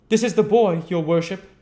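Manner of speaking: very high, loud fawning beadle; bow and scrape to the magistrate. A narrator's reading. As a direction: beadle presenting the orphan. Beadle voiced in story.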